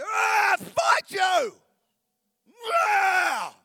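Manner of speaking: high, loud squeaky battle cry; a tiny furious man shouting up at heaven; tiny angry man. Pretend. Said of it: "How does it even go down?".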